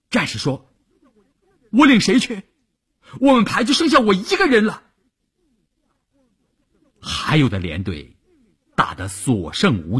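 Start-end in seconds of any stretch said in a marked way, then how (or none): 1.7-4.8 high, urgent protest; narrated distress, pitched high without sobs; distraught soldier. A narrator's reading. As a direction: protest desperately to your commander. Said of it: "有的战士哭着去找连长说".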